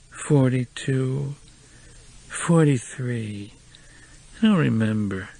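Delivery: very slow slurred voice; drunk. A narrator's reading. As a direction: drag the words out drunk.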